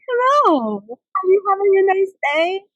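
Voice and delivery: put-on very high, loud, sing-song baby talk to a dog; high-pitched dog voice. Shown as a demonstration. Crouched down greeting a stranger's dog, very high baby talk. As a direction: coo way up high like greeting a puppy. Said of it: "my high-pitched voice".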